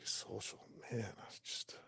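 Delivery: barely voiced, drained whisper; faint, worn-out murmur; exhausted mumble. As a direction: mumble like you're starved and spent. Retold exhausted mumble in captivity.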